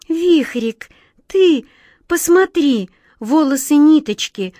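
a touch of sorrow in the outcry; sorrowful exclaim. A narrator's reading. Glazastik, sorrowful, shows Vikhrik the scattered hair. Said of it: "горестно воскликнула Глазастик".

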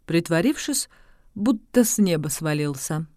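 ordinary delivery, nothing marked

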